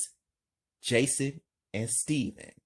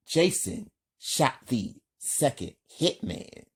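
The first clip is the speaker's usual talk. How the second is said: plain reading voice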